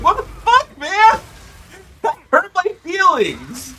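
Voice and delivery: high, loud, indignant what the fuck; outraged shriek. Retold or imitated. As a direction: squeal it out in mock outrage. Outraged reaction to game insult.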